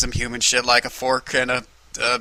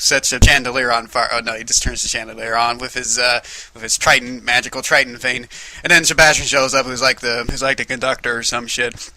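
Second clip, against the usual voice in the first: ordinary talk, nothing marked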